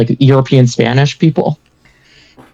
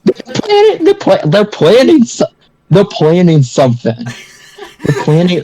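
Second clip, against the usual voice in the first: excited; 4 semitones higher than usual. Acted.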